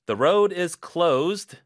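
slow loud fed-up the road is closed; frustrated announcement. Acted. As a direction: call out the bad news, fed up.

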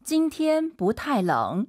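slow, evenly spaced Mandarin model sentence; careful teaching pace. Shown as a demonstration. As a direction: pronounce each syllable slowly for learners. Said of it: "선생님처럼 이제 천천히 발음하시니까".

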